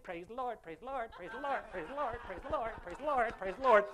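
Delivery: mechanical repeated praise; a hint of a robot churchgoer. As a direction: rattle it off like a wound-up toy. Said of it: "like a little robot".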